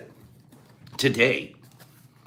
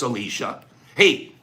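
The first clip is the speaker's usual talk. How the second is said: usual speaking voice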